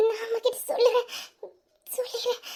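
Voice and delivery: soft words breaking into sobs; tearful. Acted. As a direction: fight back tears as you speak. Child crying after beating.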